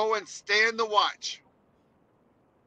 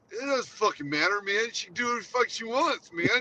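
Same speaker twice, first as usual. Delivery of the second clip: lightly voiced stoner dude; lazy stoner mockery; 3 semitones higher, 5 dB louder, faster than usual. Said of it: "Now you sound like [name] and [name]".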